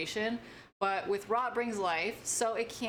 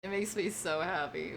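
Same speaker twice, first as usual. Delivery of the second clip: normal talking voice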